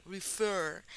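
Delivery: "refer" with a stronger second syllable; stress on last syllable. Shown as a demonstration. Teacher demonstrating the elevated sound, stress on refer's final syllable. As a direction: let the end of "refer" carry the stress. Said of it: "es más elevado".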